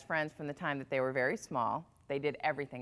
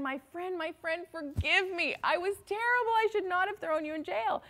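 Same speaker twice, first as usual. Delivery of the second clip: much higher, begging voice; pleading king; 13 semitones higher than usual. Acted. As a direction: plead with your friend for forgiveness. King begging friend's forgiveness.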